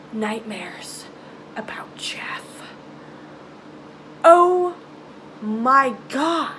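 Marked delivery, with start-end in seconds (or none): 0.1-2.4 hushed dread, whispered; whispered out of fear. Real, not acted. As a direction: drop to a whisper, spooked. Hushed dread about nightmares.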